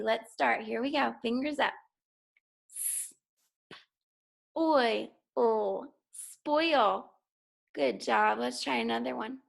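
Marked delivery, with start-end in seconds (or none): 2.7-7.1 each sound tapped out separately, then blended into spoil. Shown as a demonstration. Teacher tapping out sounds on fingers. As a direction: tap out s, p, oil, then say spoil. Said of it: "tap out the sounds".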